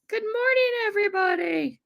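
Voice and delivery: very high, singsong good morning; falsetto greeting. Pretend. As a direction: chirp it up high like a cartoon.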